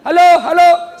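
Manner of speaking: loud, high phone bellow; acted villager yelling hello; hollering into phone. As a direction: shout down a line nobody answers. Shouting into upside-down phone.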